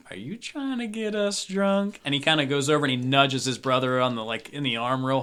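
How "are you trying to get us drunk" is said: a higher, wary gruff voice; suspicious cowboy. Acted. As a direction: squint at the drink and ask. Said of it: "he takes it and he goes".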